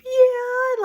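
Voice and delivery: high, loud voice-breaking yeah; tearful yeah. Retold or imitated. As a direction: let the yes crack through tears. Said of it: "like, I just kept crying".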